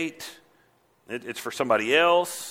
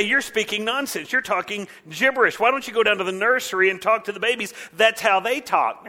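higher-pitched jeering rebuke; mocking; 7 semitones higher, 3 dB louder than usual. Priests dismissing Isaiah's preaching as gibberish, mocking. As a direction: pile on the scorn in a raised pitch. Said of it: "and totally mocking".

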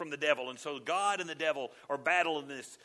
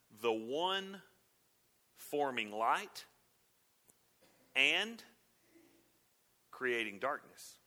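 slow, measured reading of the verse; scripture reading; 3 semitones lower, slower than usual. Acted. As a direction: lay each phrase out slowly, with weight. Reads scripture verse aloud.

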